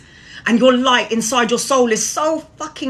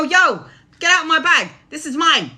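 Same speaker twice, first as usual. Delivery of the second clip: higher, louder, no-nonsense order; firm demand; 9 semitones higher, 3 dB louder than usual. Pretend. Telling a stranger to get out of your bag, firm and loud. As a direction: tell the stranger off, firmly. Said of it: "You go".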